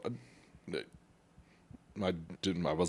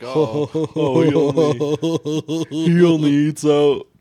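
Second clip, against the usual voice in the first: mock voice; 5 semitones higher, 8 dB louder, much slower than usual. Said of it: "That, you know, like".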